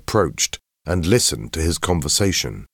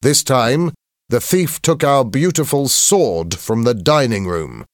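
raised, higher announcing voice; stern headmaster; 7 semitones higher, 3 dB louder than usual. A narrator's reading. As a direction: address the whole assembly gravely. Headmaster addresses assembly.

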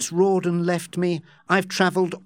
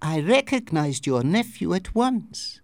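put-on higher-pitched voice with a French accent; 5 semitones higher than usual. A narrator's reading. Masked woman to Joss at roulette, French accent. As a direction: speak English with a French accent. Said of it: "with a French accent".

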